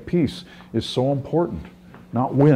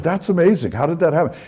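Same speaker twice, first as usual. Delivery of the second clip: amazed; 7 semitones higher than usual. Retold or imitated. Pastor retells his own amazed question.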